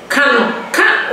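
ordinary talk

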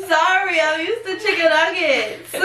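giggling through the words; laughing. Real, not acted. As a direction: laugh while apologizing. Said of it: "What are you doing?".

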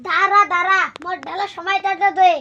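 loud, eager stop call. Acted. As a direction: shout wait, wait over the pouring. Calling out while pouring drink. (জোরে ডাক)

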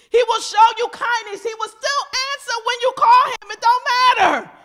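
loud, high preacher's shout; full-force preaching. Real, not acted. Preacher demanding respect for a holy God, full-force shout. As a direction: drive it home at full volume.

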